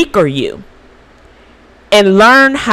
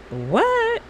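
mock surprise; 9 dB quieter than usual. Mock reaction to returned gift.

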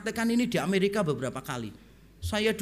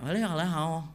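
mock Mandarin; 4 semitones lower than usual. Preacher answering old driver in kind, mock Mandarin. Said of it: "saya jawab pakai Mandarin".

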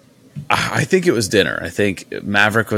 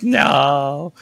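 dismayed groan; 6 semitones higher than usual. Retold or imitated. Woken by crying kids at night.

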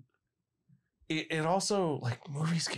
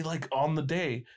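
normal talking voice

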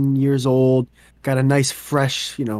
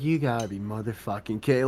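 low, quiet disbelief; head-down dismay; 3 semitones lower, 9 dB quieter than usual. Acted. Worker sees the huge video timestamp, quietly dismayed. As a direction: mutter it in defeat, head hanging. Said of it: "he put his head down".

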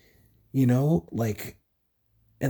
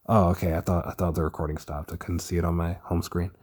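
ordinary talk

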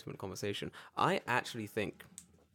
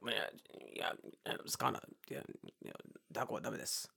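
soft, mumbled fisherman talk; unintelligible fisherman; 4 semitones lower, 10 dB quieter than usual. Acted. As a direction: mumble like a hard-to-understand fisherman. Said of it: "word of that fisherman's Japanese".

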